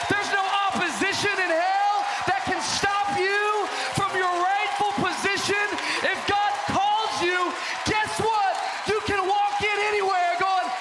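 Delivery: high, loud fiery preaching shout; full-force preaching. Acted. Preacher driving home the lesson to the church, fiery shout. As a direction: whip the congregation into praise.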